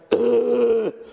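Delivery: heavy spluttering choke; hacking cough. Shown as a demonstration. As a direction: cough like you swallowed the ocean. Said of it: "sounds a little bit more like".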